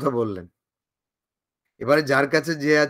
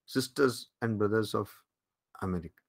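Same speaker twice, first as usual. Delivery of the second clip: very slow and softer, dying away at the end; slow fading quote; 5 semitones lower, 8 dB quieter, much slower than usual. Retold or imitated. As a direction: let the famous words fade out. Quoting Vivekananda, fading. (ধীরে, মিলিয়ে যাওয়া উদ্ধৃতি)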